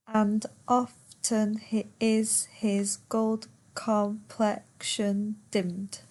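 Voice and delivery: slow, syllable-by-syllable verse reading; per suku kata. Shown as a demonstration. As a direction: count out the line, one beat per syllable. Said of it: "Ten syllables".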